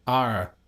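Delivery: "R" with the final r sounded; "R" as a demo of a rhotic r; practised American R. Shown as a demonstration. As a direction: say "R" low, practising a hard American r. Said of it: "what if I just did the R's".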